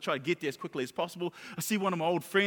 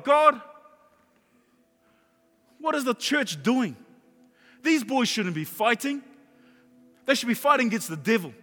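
loud, raised cry to God; angry plea; 5 semitones higher, 5 dB louder than usual. Retold or imitated. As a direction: re-enact demanding answers from God. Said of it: "And I'm angry".

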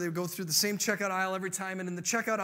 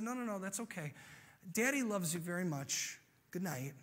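soft, tender bedtime voice; reassuring father; 6 dB quieter than usual. Acted. Dad says good night to his daughter, reassuringly.

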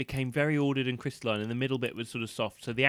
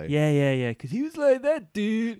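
laid-back surfer dude, pitched way up; 9 semitones higher, 7 dB louder, slower than usual. Retold or imitated. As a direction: drawl it like a stoner turtle. Imitating surfer turtle Michelangelo.

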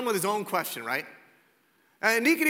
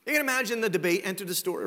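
ordinary delivery

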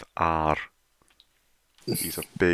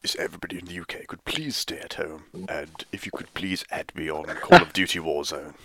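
mock-solemn address in a put-on voice; comic impression. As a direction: do an impression making a public appeal. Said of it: "That was a good impression".

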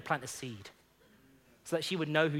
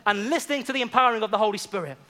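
louder, rousing sermon delivery; preaching at full force; 4 semitones higher, 6 dB louder than usual. Acted. Pastor rallying the congregation to evangelize, rousing preaching. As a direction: stir the church to go and witness.

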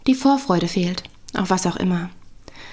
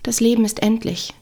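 usual speaking voice

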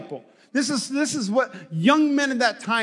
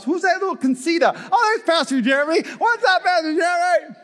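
hammed-up greeting; 7 semitones higher than usual. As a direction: shout a cheerful hello across the street. Greeting from heaven mansion.

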